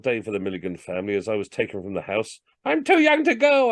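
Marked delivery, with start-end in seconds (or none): 2.6-3.7 put-on high, loud childish wail; high scream of a boy too young for war; screamed protest. A narrator's reading. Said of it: "I screamed".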